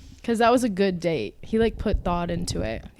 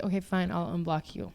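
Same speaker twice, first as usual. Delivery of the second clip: quiet; 4 semitones lower, 10 dB quieter than usual. Retold or imitated. Reluctantly giving in.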